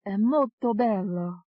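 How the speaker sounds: slow, high Italian phrase, savoured; Italian accent. A narrator's reading. Second alter praising Rome, slow Italian accent. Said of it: "You have an Italian accent".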